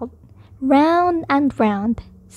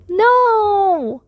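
high, long drawn-out shout; 7 semitones higher than usual. A narrator's reading. Child saving Rabbit from the wash, shouting. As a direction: stretch it out in a high, protesting cry. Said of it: "I shouted".

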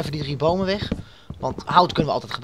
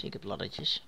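breathy whispered laddertjes; whispering; 8 semitones lower, 13 dB quieter than usual. Shown as a demonstration. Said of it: "Laddertjes, dat klinkt ook eens zo".

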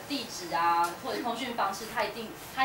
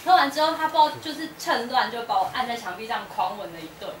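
ordinary talk, nothing marked

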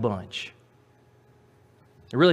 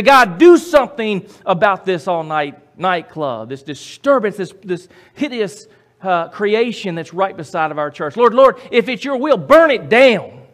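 loud, raised, imploring preacher; fervent pleading prayer; 7 semitones higher, 11 dB louder than usual. Acted. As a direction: call on God, building to a cry. Acted fervent prayer against nightclub.

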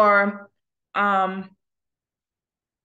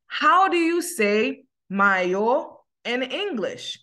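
slow, deliberate teacher model; slow model question; 3 semitones higher, slower than usual. Shown as a demonstration. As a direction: spell out each question for the class. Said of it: "ou di".

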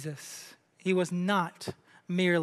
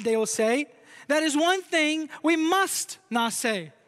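higher-pitched, declamatory reading of a quotation; emphatic quote reading; 4 semitones higher than usual. Acted. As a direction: read it out with deliberate weight on each key phrase. Reads a C S Lewis quote aloud.

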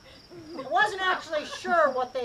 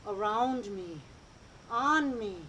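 slow, soft meditation voice; guided meditation; 4 semitones lower, 7 dB quieter, slower than usual. Acted. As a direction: ease them into calm.

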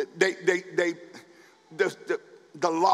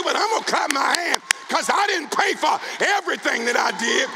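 much higher and louder, shouting praise; preaching at full force; 6 semitones higher, 3 dB louder than usual. Acted. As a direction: whip the congregation up, shouting.

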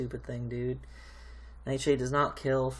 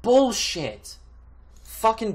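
loud, higher, bitter curse; angry outburst; 7 semitones higher, 7 dB louder, much slower than usual. Real, not acted. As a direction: spit it out in anger.

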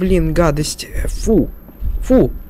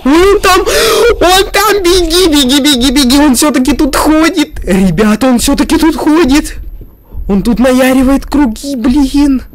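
panicked shouting; 6 semitones higher than usual. Real, not acted. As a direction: shout in real fright. Monster spotted again.

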